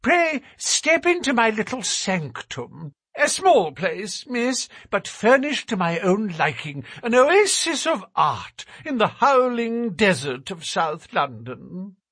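high, thin nervous little man. A narrator's reading. Narrator voices Thaddeus.

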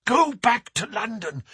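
high, pressing command; urgent cry. A narrator's reading. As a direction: urge him to leave now. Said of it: "she cried".

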